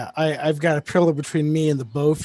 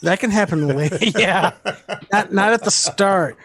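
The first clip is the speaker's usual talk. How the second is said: laughing; 5 semitones higher, 5 dB louder than usual. Real, not acted. Echoing friend, laughing.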